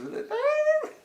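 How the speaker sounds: high squeaky falsetto demo of a nasal sound; nasal falsetto squeal. Shown as a demonstration. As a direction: squeal high as if singing through the nose. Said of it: "makes you sing like that".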